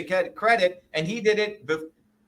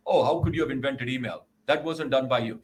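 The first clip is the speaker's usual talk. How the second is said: lightly voiced mocking critic, lower; 5 semitones lower than usual. Mocking the critics who doubt him, lightly voiced sneer. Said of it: "I found the most racist people are Indians".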